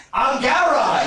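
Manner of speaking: high, loud, laughing reveal; proud announcement. Retold or imitated. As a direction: announce it with a grin. Actor announcing he is Gowron.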